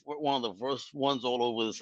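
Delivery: ordinary delivery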